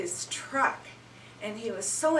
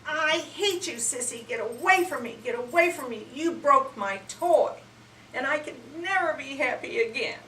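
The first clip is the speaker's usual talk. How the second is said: put-on high, louder, upset child yelling; angry brother; 6 semitones higher, 5 dB louder than usual. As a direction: yell it like a furious little boy. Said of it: "and he said".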